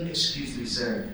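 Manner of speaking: low, hushed theatrical plea; quiet poetic begging, deep and measured; poetic monologue. Acted. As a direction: deliver a soft, solemn monologue to tug at heartstrings. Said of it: "like, really poetic monologue".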